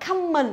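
"common" as "cammin" with an A vowel; learner's "common", first vowel like "come"; learner mistake. Retold or imitated. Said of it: "rất nhiều người đọc như vậy".

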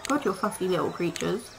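normal talking voice

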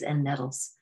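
ordinary delivery

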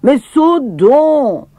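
lightly voiced Alfred calling up; a hint of a pleading farmhand. A narrator's reading. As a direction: urge her to jump, drawn out. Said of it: "cria Alfred".

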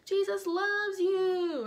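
slow, high cheerful chant; drawn-out friendly preaching; sing-song preachers. Acted. Peter and John greet the beggar, slow cheerful chant. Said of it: "Peter and John come walking".

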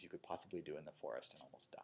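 hushed whisper for the near-death extreme. Acted. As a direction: drop to a breathy whisper. Extremes of ascetic practice.

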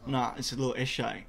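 ordinary talk

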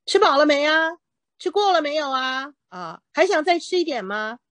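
lightly voiced mom checking if you ate; teacher imitating a Chinese mom asking if you ate, lightly; caring mom voice. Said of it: "就是用这种口气的".

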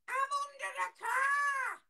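very high, drawn-out wail; baby voice. Acted. Hidden prank child telling rescuers, wailing baby voice. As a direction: squeal it slowly like a trapped toddler. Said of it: "The, the baby voice".

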